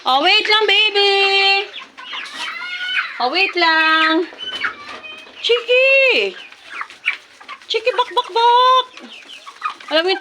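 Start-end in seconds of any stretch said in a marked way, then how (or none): 0.0-4.3 high, drawn-out cooing to a pet; fond cooing. Real, not acted. Vlogger soothing her hungry chicken. 5.4-8.9 high calling clucks. Real, not acted. Vlogger calling her chickens over to eat.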